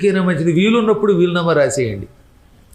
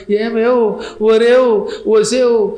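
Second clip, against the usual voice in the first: loud calls, pitched up; calling out; 5 semitones higher, 3 dB louder, much slower than usual. Acted. Dying man calls to wife and children in alarm. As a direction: shout for the family. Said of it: "అందరినీ అరిచి పిలుద్దాం అనుకుంటాడట".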